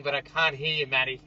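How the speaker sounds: normal talking voice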